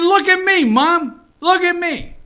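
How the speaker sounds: high, loud call to Mom; excited dog voice. Acted. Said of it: "he's saying".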